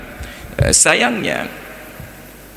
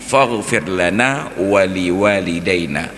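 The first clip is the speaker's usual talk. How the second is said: slow careful recitation; slower than usual. Shown as a demonstration. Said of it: "ighfir itu mutaaddi dengan lana".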